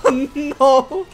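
high, loud mock wail; mock dismay. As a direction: wail it in hammed-up horror. Mock lament over a dying player.

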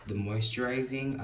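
normal talking voice

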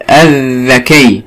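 "الذكي" very slowly, with a long held dh after the silent lam; doubled consonant. Shown as a demonstration. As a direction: stretch "الذكي" into "adh-dha-kiyy". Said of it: "اللي بعد اللام مشدد". (نطق "الذكي" ببطء مع تشديد الذال)

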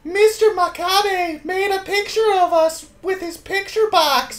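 put-on sing-song little girl diary voice. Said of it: "Read it in a little girl voice".

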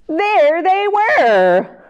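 high, loud and slow; delighted reveal. Acted. Storyteller revealing the found ducklings to young listeners, delighted. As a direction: savour the big reveal.

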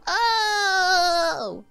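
real high-pitched wailing dismay; anguished disbelief. Real, not acted. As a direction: voice climbing to a squeal, then a wail. Disbelief at losing Pokemon.

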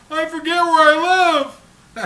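high, loud hammed-up old man; punchline as a distraught old man. Old man confesses to young guy, loud high wail. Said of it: "He goes".

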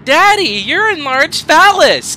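put-on high, loud kid yelling to daddy; child's voice. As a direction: pipe up like an excited child, drawn out. Imagined child shouting at daddy.

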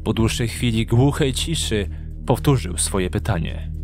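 ordinary delivery

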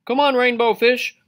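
high, loud friendly call. A narrator's reading. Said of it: "they would call".

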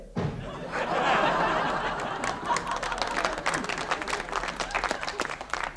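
breathless silent laughing; wheezy laugh. Real, not acted. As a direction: laugh through the punchline. Comedian laughs at own joke.

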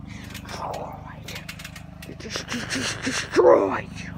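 soft, drawn-out menace; hushed villainous muttering; villain threat. Acted. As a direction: stretch it out like an evil overlord.

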